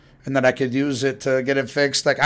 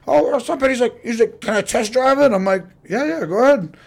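high dad voice; 10 semitones higher, 3 dB louder than usual. Retold or imitated.

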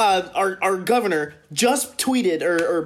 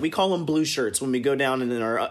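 ordinary talk

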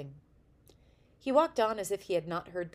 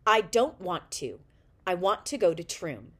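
put-on louder, sulky stubborn refusal of a boy with crossed arms; 10 dB louder than usual. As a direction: pout and refuse loudly. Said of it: "sat down heavily and crossed his arms".

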